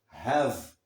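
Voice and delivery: "have" with a silent final E, ending on v; English "have", no vowel after the v; correct English have. Shown as a demonstration. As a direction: say "have" as one syllable. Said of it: "o E não é pronunciado".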